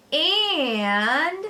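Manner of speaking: long, high, loud drawn-out and; stretched out. A narrator's reading. Fudge stretching the word for attention. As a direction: drag the word out. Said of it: "he stretched out the word".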